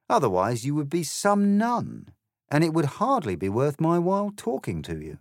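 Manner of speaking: high, loud, unimpressed caterpillar; dismissive caterpillar. Acted.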